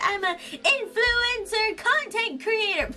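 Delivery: high, drawn-out mock boast; mocking self-description. YouTuber mocks telling people her job, high mocking voice. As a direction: stretch each title out in a high silly voice.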